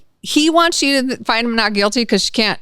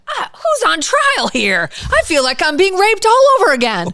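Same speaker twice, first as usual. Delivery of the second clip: indignant wail; 10 semitones higher, 4 dB louder than usual. Retold or imitated. Victim's outburst on the stand.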